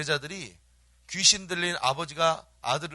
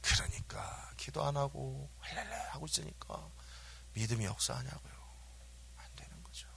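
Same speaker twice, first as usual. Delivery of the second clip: very soft, partly whispered; hushed aside; 3 semitones lower, 14 dB quieter than usual. Real, not acted. Preacher chiding those who skip prayer, hushed near-whisper. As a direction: drop right down to a near whisper.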